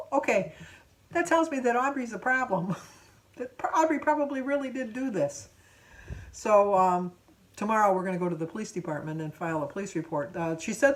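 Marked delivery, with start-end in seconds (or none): 0.0-5.5 laughing. Real, not acted. Laughing at the clerk's reaction.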